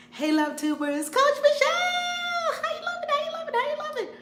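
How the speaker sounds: high-pitched excited hailing; calling out greetings. Real, not acted. Host greeting Love Tubers as they join, excited calling. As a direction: squeal out happy hellos.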